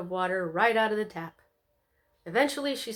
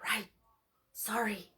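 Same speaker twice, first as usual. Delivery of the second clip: whisper; 6 dB quieter than usual. A narrator's reading. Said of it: "whispered Luna".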